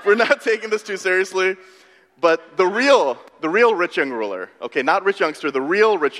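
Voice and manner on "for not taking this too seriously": retold amusement breaking into laughter; laughing thanks. Preacher retelling his prayer thanking Jesus, laughing. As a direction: give thanks through a laugh, pitch lifting. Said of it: "I was laughing about".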